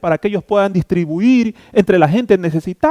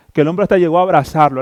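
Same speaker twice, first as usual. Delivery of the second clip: normal talking voice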